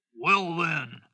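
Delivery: lightly voiced troll dismissal; a hint of storybook troll; grudging troll. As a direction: give in as a troll, mildly gruff. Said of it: "said the troll".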